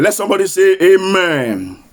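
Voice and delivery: ordinary talk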